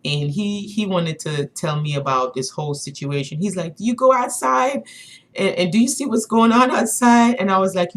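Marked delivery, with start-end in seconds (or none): 3.8-7.4 higher-pitched retold rant; mock impassioned, pitched up; imitating passionate man. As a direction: act out his worked-up question. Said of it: "he was so passionate about what he was talking about".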